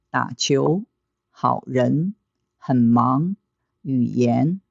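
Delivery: 可能, 旅游: first syllable low, second rising; low, then rising. Shown as a demonstration. Teacher drills third plus second tone, 很忙 low then rising. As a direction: read each word like 美国, low then sweeping up. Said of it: "Third tone plus second tone".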